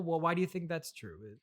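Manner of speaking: usual speaking voice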